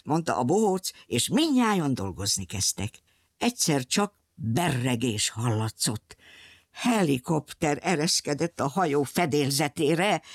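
ordinary delivery, nothing marked

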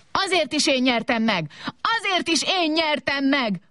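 high-pitched stubborn squabbling; quarrelsome insistence. A narrator's reading. Robots fighting over who won, stubbornly insistent. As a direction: insist stubbornly, pitch rising further on the repeat. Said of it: "verekedtek tovább".

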